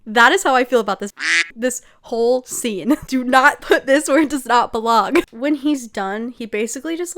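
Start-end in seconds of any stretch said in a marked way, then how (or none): 3.1-5.2 louder, exasperated mock scolding; emphatic mock warning. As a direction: punch out the warning loudly. Repeats trend warning about the scene.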